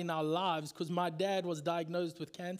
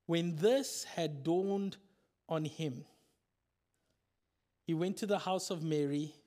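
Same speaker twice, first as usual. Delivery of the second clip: scripture reading; 5 semitones lower, 3 dB quieter than usual. Acted. Reading scripture passage aloud.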